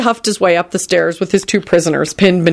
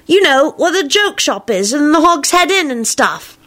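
put-on high boy's voice; eager Teddy; 9 semitones higher than usual. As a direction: chatter like a young boy. Teddy describes Hogsmeade.

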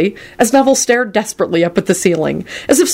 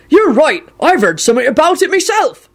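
booming, raised-pitch agreement; Hagrid; 10 semitones higher than usual. A narrator's reading. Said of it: "Hagrid boomed out".

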